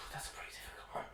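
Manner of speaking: breathy whispered musing over a hard question. Real, not acted. Friend weighs a hard question, whispering to himself. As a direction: whisper it to yourself, thinking. Pondering sexiest accent.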